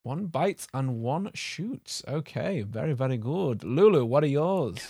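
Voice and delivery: soft, slow, put-on Norse accent; rough Norse accent. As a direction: vague mock-Norse accent, hushed and measured. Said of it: "What was that accent?".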